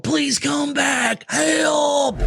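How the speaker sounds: slow, drawn-out pleading call, then a yelp for help; hammed-up cry for him to come back; mock desperate plea. Mock Trump fan begging Donald to return, wailing.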